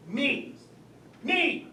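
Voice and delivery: starts level, climbs to a loud high cry; rising panic, me me ME; building to a shout. Acted. Panicked at speaking to guests.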